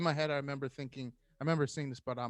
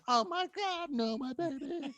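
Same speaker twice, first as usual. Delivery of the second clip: high, wailing mother voice; a hammed-up crying mom; mock distraught mom; 13 semitones higher, slower than usual. Son imitating his mom over a stubbed toe, high wail. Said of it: "she would start crying".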